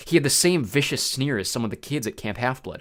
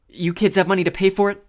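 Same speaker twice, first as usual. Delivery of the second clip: high, louder waitress asking sharply; a wary diner waitress, pitched up; 9 semitones higher, 7 dB louder than usual. A narrator's reading. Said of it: "She asked us again".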